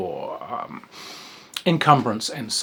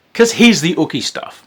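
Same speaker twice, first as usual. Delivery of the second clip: loud, high, gleeful tease; gleefully loud reveal of the ooky stuff; excited spooky build-up; 6 semitones higher, 10 dB louder than usual. Real, not acted. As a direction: ramp it up for the gory bit. Building up to gory content.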